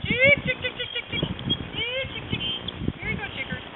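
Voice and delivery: high soft chick-chick call; calling chickens. Real, not acted. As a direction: coax the hens in high and soft. Calls chickens to sunflower nuts.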